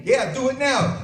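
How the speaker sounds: high, slow, eager yes now; drawn-out cue to go now; husband urging his wife, high and slow; urgent go-ahead. Acted. Husband cues the moan.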